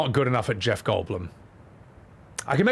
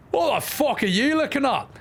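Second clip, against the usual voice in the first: much higher, snarled Scottish challenge; rough Scottish accent; 10 semitones higher than usual. Acted. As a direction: throw it out like a rough Scottish mum squaring up. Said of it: "The mum should be the rough Scottish".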